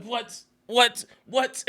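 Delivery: high-pitched repeated disbelief; comic, squeaky-high astonishment; mock shock. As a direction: imitate a stunned father, each word pitched high. Acting out father's shocked discoveries.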